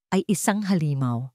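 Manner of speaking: ordinary talk, nothing marked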